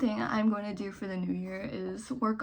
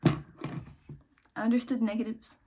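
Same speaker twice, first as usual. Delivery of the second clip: ordinary talk, nothing marked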